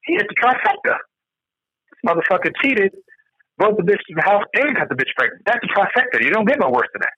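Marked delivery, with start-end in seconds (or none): 0.0-5.4 rising shocked exclamation; shocked reaction. Retold or imitated. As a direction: react like you just heard wild news. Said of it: "I'm like".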